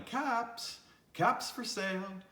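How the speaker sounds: high-pitched vendor's street cry; peddler calling. A narrator's reading. Peddler back in town selling caps, calling out. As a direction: call out wares in a lifted singsong.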